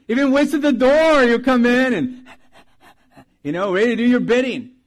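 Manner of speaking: excited. Retold or imitated. Describing an eager dog.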